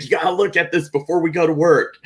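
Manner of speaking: high, quick and eager; excited urging. Retold or imitated. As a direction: urge someone to look, thrilled. Retells excitedly showing the podcast news.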